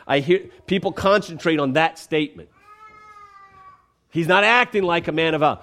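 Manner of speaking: ordinary talk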